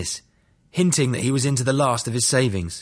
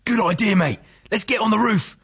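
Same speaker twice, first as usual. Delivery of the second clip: mild narrated shout; 8 semitones higher than usual. A narrator's reading. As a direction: call it out eagerly, pitched well up. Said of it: "Another prisoner shouted".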